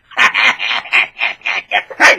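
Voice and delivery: loud, high mock cackle; hammed-up evil laughing; gloating villain laugh.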